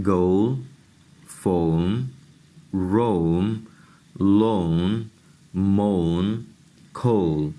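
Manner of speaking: careful word by word o sound; teacher demonstrating o plus e words; word list demo. Shown as a demonstration. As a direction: say گول and مون with a long o before the consonant. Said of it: "دیکھ لیجئے".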